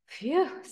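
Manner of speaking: high, loud "phew"; acted puff of relief; relieved sigh. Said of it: "Evergreen sighed".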